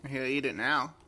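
usual speaking voice

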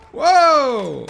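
loud, high whoa falling away; startled whoa. Real, not acted. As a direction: let out an astonished shout. Startled by own grenade.

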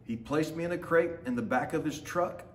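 usual speaking voice

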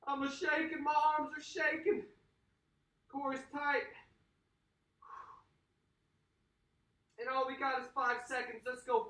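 high, loud pumped-up coaching; hype coach. Acted. Workout coach firing up the class, high and loud. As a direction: fire up the class like a trainer.

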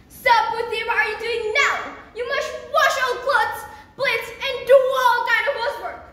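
high, loud ordering; bossy stepmother. Acted. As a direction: bark out the chores. Stepmother bossing Putih around.

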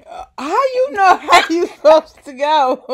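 loud laughing question. Real, not acted. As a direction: ask through laughter. Mother laughing at son.

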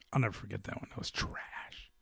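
hushed, disgusted aside; whispered verdict. Real, not acted. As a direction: drop to a whisper, dragging out the last word. Muttered verdict on a bad fight.

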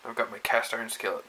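normal talking voice

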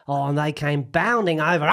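put-on old Australian man, bounding over stretched out; aging Australian husband on TV, broad accent; Australian impression. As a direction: imitate an old Aussie bloke on the news. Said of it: "That's exactly it".

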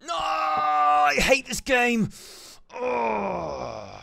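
long, high, drawn-out scream of frustration; frustrated wail. Real, not acted. Player losing at a frustrating game, anguished high wail. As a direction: wail loudly, then let the cry sink. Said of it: "this game is so frustrating".

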